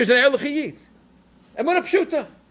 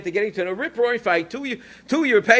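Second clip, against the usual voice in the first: usual speaking voice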